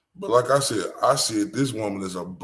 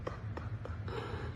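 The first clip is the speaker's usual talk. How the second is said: quiet laugh; 17 dB quieter than usual. Real, not acted. Laughing at toddler.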